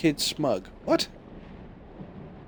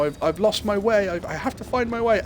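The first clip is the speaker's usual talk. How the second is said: 1 whiny hero; 7 semitones higher than usual. Retold or imitated. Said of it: "he's going".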